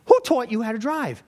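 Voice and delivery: high-pitched acted outrage; hammed-up exasperated scolding; mad passenger. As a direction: berate the driver with indignant questions.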